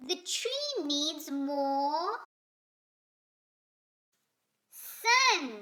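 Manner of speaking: building to an exclamation. Acted. Esther's conclusion, bursting out on "sun.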